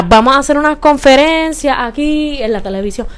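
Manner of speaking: ordinary delivery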